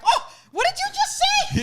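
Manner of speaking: high, loud shocked gasp of a question; aghast auntie catching a bad word; mock-scandalized auntie. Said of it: "Auntie was like".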